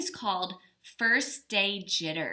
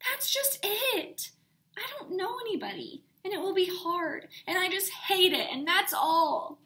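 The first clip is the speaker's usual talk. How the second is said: put-on a much higher, whiny child's wail; upset child; 10 semitones higher than usual. A narrator's reading. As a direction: pour out all her worries in one breath. Sarah's upset complaint.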